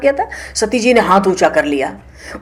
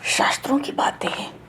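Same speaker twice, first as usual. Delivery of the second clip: hushed advice; 10 dB quieter than usual. Real, not acted.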